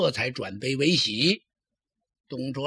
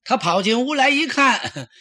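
ordinary delivery, nothing marked

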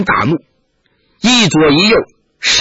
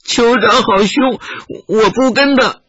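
put-on higher small child's voice, slow and whimpering; crying child; 7 semitones higher than usual. A narrator's reading. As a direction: plead like a scared crying child. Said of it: "孩子哭道".

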